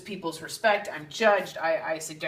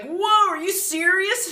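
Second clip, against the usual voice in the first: much higher, louder gasp of disbelief; amazed friend; 12 semitones higher, 7 dB louder than usual. Retold or imitated. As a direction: gush like a stunned girlfriend.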